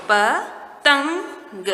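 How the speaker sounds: पतंग stretched very slowly, syllable by syllable; slow sounded-out reading. Shown as a demonstration. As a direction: draw out पतंग slowly so children hear each sound. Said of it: "हम इसको पढ़ेंगे". (पतंग को धीरे-धीरे खींचकर बोलें)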